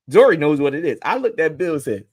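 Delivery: normal talking voice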